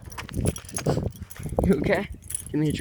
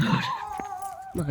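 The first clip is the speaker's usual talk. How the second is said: coaxing call; 5 dB quieter than usual. Real, not acted. Coaxing hesitant dog.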